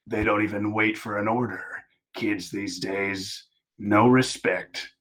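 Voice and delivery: put-on slow, scowling old hero; grumbling Batman. Batman under fire complains about kids, slow grumble. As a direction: grumble it slowly as a weary Batman.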